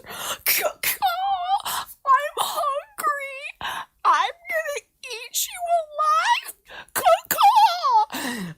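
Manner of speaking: very high, loud cackling vulture cry; shrieking vulture. Acted. Storyteller voicing the hungry vulture circling the bird, high screeching cackle.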